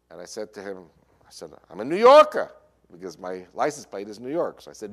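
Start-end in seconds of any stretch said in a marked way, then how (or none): 1.7-2.5 put-on loud, high New Yorker accent. Traveller proving himself to border officer, loud New York accent. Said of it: "like a New Yorker speaks".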